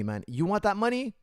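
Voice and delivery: ordinary delivery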